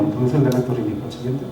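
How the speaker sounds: normal talking voice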